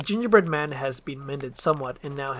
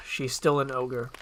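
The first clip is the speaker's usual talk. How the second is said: plain reading voice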